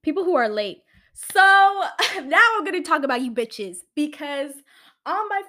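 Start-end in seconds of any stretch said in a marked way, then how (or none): 1.4-2.2 laughing. Real, not acted. Laugh before calling friends out.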